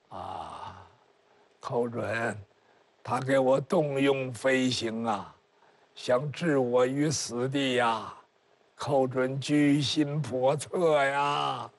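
low, slow, faint dying voice; a weak old man whining out his complaint; feeble old man. Acted. Feeble old minister accuses Kou Zhun. (低沉缓慢的虚弱老人声)

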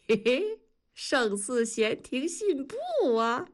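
high, drawn-out mocking recitation; teasing echo. A narrator's reading. Friend mocking him as the woman approaches, singsong. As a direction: tease him with his own verse.

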